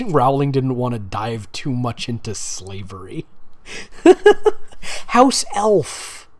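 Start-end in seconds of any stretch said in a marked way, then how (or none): 3.3-5.0 high breathless laugh; squealing giggle fit; burst of laughter. Real, not acted. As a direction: crack up at the text. Reader cracks up at text.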